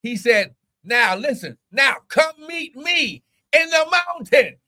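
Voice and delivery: high, drawn-out preacher's cry; full-force preaching. Real, not acted. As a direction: preach it high, leaning on key words.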